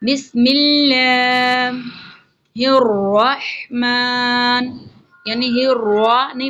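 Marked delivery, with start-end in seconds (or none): 0.0-1.7 بسم اللہ with a thin, light lam, slow. Shown as a demonstration. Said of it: "لام یہاں پر باریک پڑھا جائے گا". 2.5-4.8 الرحمن with a breathy Arabic ح, slowly drawn. Shown as a demonstration. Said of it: "ہی راہ پڑھیں گے".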